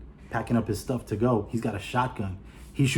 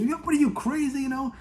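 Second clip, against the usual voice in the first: mock yell. Keanu yelling mid-skydive.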